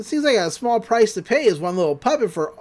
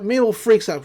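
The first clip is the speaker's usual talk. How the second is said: ordinary talk, nothing marked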